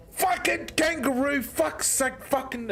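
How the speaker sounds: swearing. Retold or imitated. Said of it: "I'm swearing my head off".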